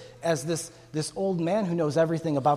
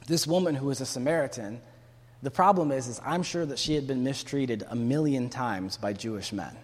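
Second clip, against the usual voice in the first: ordinary delivery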